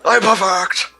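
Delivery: high, loud villain yell; hammed-up boast; Perfect Cell scream. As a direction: shout it with villain pride. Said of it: "he just goes screaming that".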